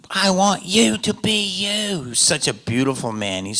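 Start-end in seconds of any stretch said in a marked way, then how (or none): 0.1-2.0 put-on slow, high Australian accent; high, lingering mock-Australian voice; Baz. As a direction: imitate an encouraging Australian director, drawing it out. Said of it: "I'll try to do the accent".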